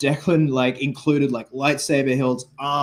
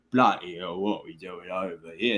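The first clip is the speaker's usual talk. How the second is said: low, softer Cockney grumble; Cockney-leaning English accent; 4 semitones lower, 4 dB quieter than usual. Retold or imitated. Imitating the actor beside his posh kids, low Cockney accent. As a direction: drop down into casual Cockney. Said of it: "kinda bordering on Cockney sometimes".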